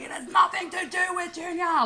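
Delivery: re-enacted high, loud angry shout; angry retort. Daughter snapping back at her mum in a retold argument, angry. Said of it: "like, I got angry".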